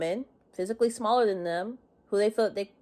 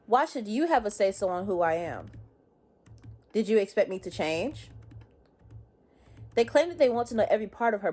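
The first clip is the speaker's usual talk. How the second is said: ordinary delivery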